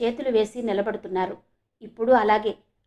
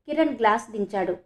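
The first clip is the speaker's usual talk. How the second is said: ordinary delivery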